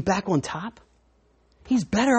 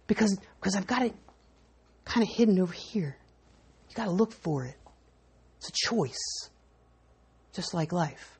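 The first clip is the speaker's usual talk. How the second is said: hushed aside; 10 dB quieter than usual. Acted. Pastor hides the gate, confides.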